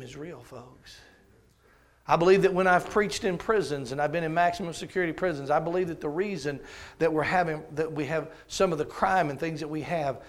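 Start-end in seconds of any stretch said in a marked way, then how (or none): 2.1-10.2 louder sermon build; preaching. Acted. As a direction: drive the point home to the pews.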